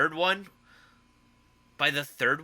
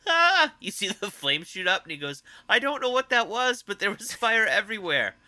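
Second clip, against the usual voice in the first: higher, a touch louder imitation of a hurt movie character; Will Ferrell impression; 6 semitones higher, 3 dB louder than usual. Cohost quoting the burned henchman scene, mock cheerful pained voice. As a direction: play it as a cheerfully battered guy insisting he's fine. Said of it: "you just hear him".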